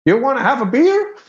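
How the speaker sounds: high-pitched, eager invitation; sudden friendliness. Retold or imitated. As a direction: act out an abrupt offer of friendship. Sudden friendliness after the standoff.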